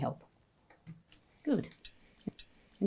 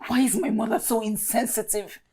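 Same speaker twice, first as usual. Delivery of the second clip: higher-pitched, indignant complaint; exasperated complaint; 5 semitones higher than usual. Acted. Daughter venting to a friend about her meddling mother, exasperated. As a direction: vent with rising frustration.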